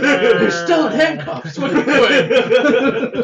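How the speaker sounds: high, slow, laughing through the words; drawn-out taunt cracking up with laughter; laughing mock voice. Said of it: "he's like".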